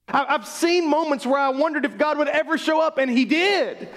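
rising sermon fervour, climbing in pitch and power; preaching at full force. Acted. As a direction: testify with everything you have. Preaching builds to a climax.

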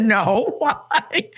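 no, why, said through real laughter; laughing in disbelief. Real, not acted. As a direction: laugh it off, amazed. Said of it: "And I broke out laughing".

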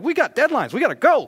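high, loud, pushy hurry; urgent A-type nagging; rushing urgency. Retold or imitated. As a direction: push her forward, impatient. A-type husband rushing wife.

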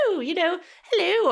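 high, prim mock English accent with a very high "hello". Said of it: "English folks as being like".

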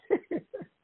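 a short high laugh at him; high mocking laugh. Laughing at the man who asks questions, high.

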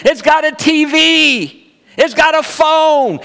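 put-on high, drawn-out toddler squeal of delight; excited little boy. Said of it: "so excited to be in the hotel room".

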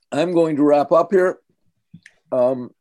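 talking around a piece of candy; chewing while talking. Real, not acted. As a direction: speak with candy in mouth. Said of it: "I'm chewing candy".

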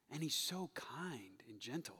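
breathy whisper of kind and gentle; hushed, reverent whisper. Real, not acted. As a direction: whisper it tenderly. Tender line about Jesus.

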